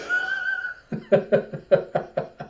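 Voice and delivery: very high, wheezy giggle; real laughter. Real, not acted. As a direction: dissolve into squeaky laughter. Laughing after finishing the recap.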